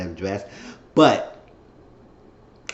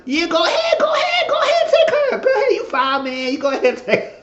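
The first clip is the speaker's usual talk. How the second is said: laughing hype. Retold or imitated. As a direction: egg him on, cackling and hollering. Phil giving his date away.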